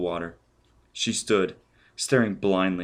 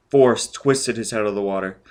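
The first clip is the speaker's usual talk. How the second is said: usual speaking voice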